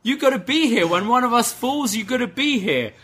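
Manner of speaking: hammed-up indignant wrestler; mock outraged Roddy. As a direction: squawk the blame at him. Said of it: "Roddy's like".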